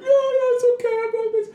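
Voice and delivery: high, squeaky mock sobbing; hammed-up crying child voice; imitating a crying kid. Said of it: "And he's crying".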